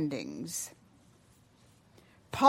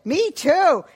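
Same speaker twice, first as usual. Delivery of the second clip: loud, high-pitched eager agreement; eager chiming in; 7 semitones higher, 10 dB louder than usual. Acted. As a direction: burst in with boyish enthusiasm. Boy eagerly chimes in.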